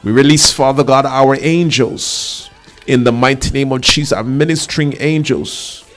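declaring prayer, commanding and insistent; fervent prayer. Real, not acted. Preacher leads the congregation praying against child abductions, fervent prayer. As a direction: pray with authority, key words stretched out.

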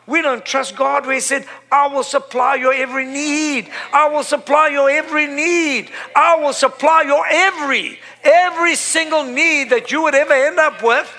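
high-pitched, ringing proclamation of scripture; forceful preaching. Real, not acted. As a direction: preach it hard, building through each repetition.

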